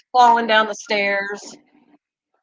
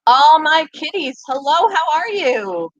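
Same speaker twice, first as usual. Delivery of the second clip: higher, slow sing-song greeting to the kitties; 6 semitones higher than usual. Real, not acted. Streamer greeting her kitties in a cooing voice. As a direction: coo hello at the cats.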